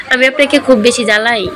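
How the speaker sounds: plain reading voice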